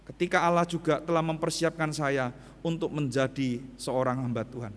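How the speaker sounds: normal talking voice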